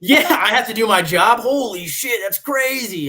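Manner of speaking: high, loud sarcastic exclaiming; mock outrage. Marine joking about having to do his job, mock outrage. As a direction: exclaim it high in sham disbelief, laughter breaking in. Mocking the idea of having to work.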